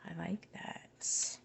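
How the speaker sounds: slow soft whisper; quiet approving whisper. Real, not acted. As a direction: say it almost to yourself. Reacts quietly to card meaning.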